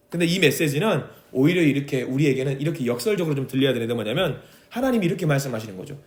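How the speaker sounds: usual speaking voice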